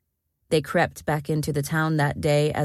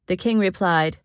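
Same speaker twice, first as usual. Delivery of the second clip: ordinary delivery, nothing marked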